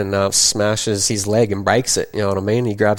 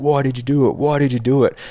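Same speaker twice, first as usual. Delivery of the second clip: mock stepdad demand, slightly higher; imitating angry stepdad; 4 semitones higher than usual. Stepdad demanding answers from a beaten child, mock angry. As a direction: repeat it like an accusing stepdad.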